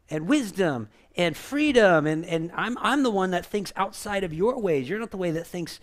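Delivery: normal talking voice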